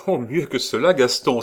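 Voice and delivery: high, loud burst of joy; excited, impulsive cry; eager exclamation. A narrator's reading. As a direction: cry out with a better idea. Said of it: "s'écria Hélène".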